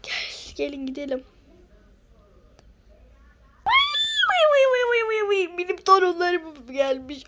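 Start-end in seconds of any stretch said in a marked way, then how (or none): 3.7-7.2 high, loud granny exclaiming; grandma thrilled to see the kids; excited grandma. Acted. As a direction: cry out with joy like an old grandma. Grandma greets arriving grandchildren.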